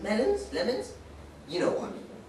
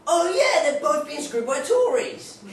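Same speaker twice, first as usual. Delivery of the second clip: punchline; 8 semitones higher, 6 dB louder, slower than usual. Acted.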